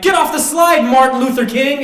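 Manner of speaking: very high, very loud taunting shout; mock bully yell. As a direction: scream it like a schoolyard bully. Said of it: "be like".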